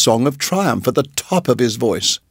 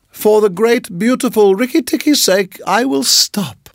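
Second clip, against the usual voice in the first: much higher, flattering chirp; little bird praising the mongoose, much higher; high fawning bird; 9 semitones higher than usual. Acted. Darzee voice.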